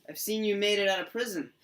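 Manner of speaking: high, loud mock amazement; excited reunion. [name] greeting a friend out of prison, excited. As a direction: exclaim it to an old pal.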